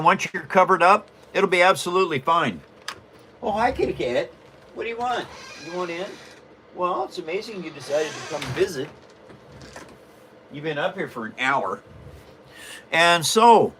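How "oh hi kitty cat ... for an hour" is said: quiet, offhand chat with a pet; soft aside to cat. Real, not acted. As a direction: talk softly to the cat, off to the side.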